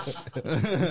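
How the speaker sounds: hearty laughing fit; loud laugh. Real, not acted. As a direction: burst of real laughter. Laughing at the joke.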